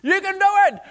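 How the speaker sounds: very high, loud parent cheer. Retold or imitated. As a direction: cheer your kid on across the park. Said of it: "we're cheering".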